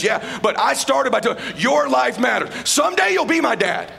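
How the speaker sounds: loud, driving motivational preaching; rally the crowd like a revival preacher; preaching at full force. Acted. Speaker urges the event audience to live fully, preaching at full force.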